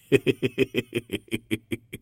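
high, breathy laughing fit; mocking laugh. Ragged man laughing at the family's plans. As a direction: let the laugh run out of control. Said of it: "خنده دوباره آغاز شد".

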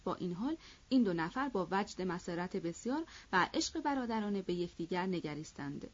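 usual speaking voice

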